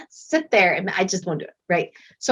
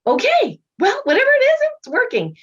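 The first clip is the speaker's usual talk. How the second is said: much higher, louder mock shrug; therapist giving in; 5 dB louder than usual. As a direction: toss it off in a high, amused voice. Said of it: "She's like".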